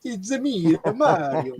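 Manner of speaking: high Italian cartoon voice; Mario impression. Friend on a video call answering as Mario in a high voice. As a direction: chirp it like a game plumber. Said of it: "it's Mario".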